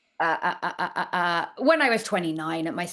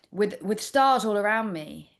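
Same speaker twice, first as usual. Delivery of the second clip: usual speaking voice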